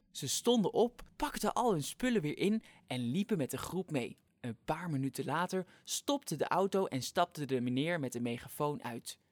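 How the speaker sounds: plain reading voice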